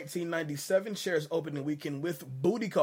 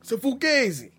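mock Italian-American; 9 semitones higher, 5 dB louder than usual. Podcast host echoing Pacino's line in a mock Italian-American accent. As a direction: echo the line high and loud in a tough-guy Italian-American accent.

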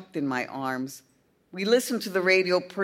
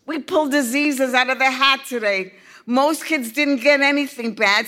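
put-on high, loud earnest young boy; a boy explaining in a rush; excited boy; 7 semitones higher, 7 dB louder than usual. A narrator's reading. Boy voiced, long speech.

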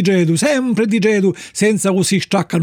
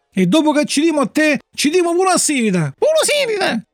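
high menace rising to a higher echo; threaten, then echo it higher; robbers' threat; 8 semitones higher than usual. A narrator's reading. Robbers threaten to kill his father too, high and menacing.

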